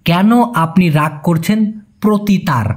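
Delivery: slow, each word of কেন আপনি রাগ করছেন প্রতি তার set apart. Shown as a demonstration. Teacher to Bengali learners, slow word-by-word gloss. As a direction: lay out the Malay word order slowly. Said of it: "এই জন্য বলতে হবে".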